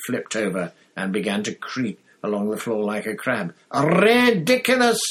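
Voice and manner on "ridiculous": high, loud yelled spell. A narrator's reading. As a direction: yell it with full force. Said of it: "yelled Dean".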